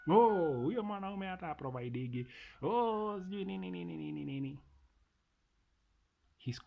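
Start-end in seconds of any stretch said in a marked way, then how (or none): 0.1-4.6 high, exasperated complaining; mock whiny wife. Parodying a wife who complains about her husband, mock whine. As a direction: lightly parody her nagging, trailing off.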